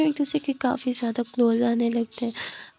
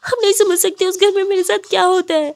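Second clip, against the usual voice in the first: higher, louder tearful outburst; crying; 7 semitones higher, 5 dB louder than usual. Acted. Ananya cries to Khushi about home, sobbing. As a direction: plead through tears to be understood. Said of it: "ज़ोरों से रोने लगती है".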